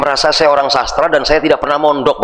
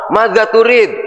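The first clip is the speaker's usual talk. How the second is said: Madza turid said high, with Arabic flair; mock Arabic speaker; 7 semitones higher, slower than usual.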